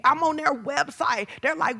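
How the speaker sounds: normal talking voice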